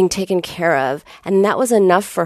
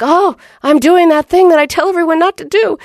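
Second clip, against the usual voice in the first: sudden realisation; 9 semitones higher, 4 dB louder than usual. Retold or imitated. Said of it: "finally, I'm like".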